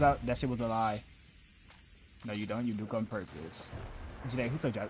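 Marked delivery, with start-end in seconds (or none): none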